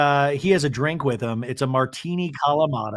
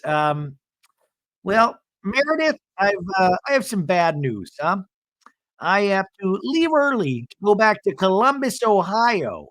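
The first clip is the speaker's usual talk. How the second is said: high-pitched hesitant husband imitation; Seth, breaking news; 5 semitones higher than usual. Seth breaking bad news to wife, high mimicry. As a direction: pitch it up and stall before the news. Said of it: "Seth goes".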